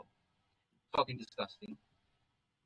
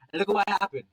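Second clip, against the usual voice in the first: angry scolding; 7 semitones higher, 8 dB louder than usual. Acted. Father scolding son.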